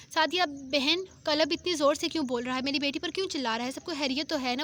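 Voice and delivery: ordinary delivery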